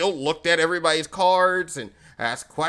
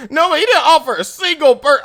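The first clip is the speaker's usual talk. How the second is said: ordinary talk, nothing marked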